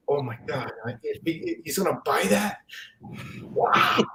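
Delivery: put-on quiet, breathless childhood awe; awed hush. Boy watching his dad pay for the comic, awed hush. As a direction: hush it like a wide-eyed kid. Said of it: "and I was like".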